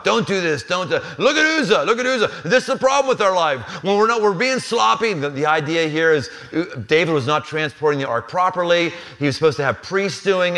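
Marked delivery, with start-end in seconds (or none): 0.0-5.1 a higher, hammed-up scolding preacher; lightly parodied pastor wagging a finger; mock preachy warning. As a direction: wag a finger at the flock in a raised voice. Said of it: "easy whipping boy for me".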